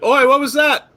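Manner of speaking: high, loud startled shout; guard calling out. Acted. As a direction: call it out sharply as an alerted guard. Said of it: "and I was like".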